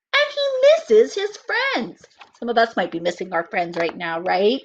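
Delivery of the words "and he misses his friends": put-on slow, lively read-aloud for children; storybook reading. A narrator's reading. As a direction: read it like story time for little kids. Reads picture book aloud.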